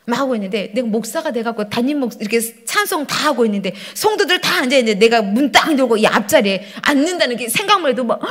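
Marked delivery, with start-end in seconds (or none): none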